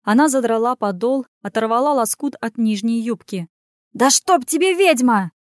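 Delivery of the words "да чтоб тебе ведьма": high, loud exclamation; cried-out curse. A narrator's reading. Gerakh curses Anya and shuts his eyes, crying out. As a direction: curse her in a yelp. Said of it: "вскрикнул Герах".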